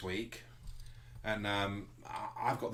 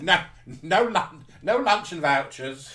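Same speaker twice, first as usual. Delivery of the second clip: high, loud, slow mock madam; seedy receptionist; 7 semitones higher, 10 dB louder, slower than usual. Receptionist refusing lunch vouchers, high mock madam. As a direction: stretch it out grandly.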